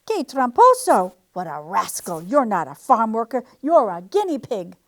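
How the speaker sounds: loud, outraged accusation; angry cry. A narrator's reading. Farmer angrily crying out at the disguised guinea pig. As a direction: call out the cheat in anger. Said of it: "cried the farmer".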